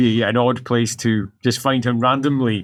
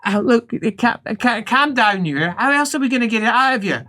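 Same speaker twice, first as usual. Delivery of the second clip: higher-pitched, stammering helper voice; flustered helper; 9 semitones higher than usual. Acted. Helper telling patient to calm down, acted flustered voice. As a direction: stumble through calming a patient.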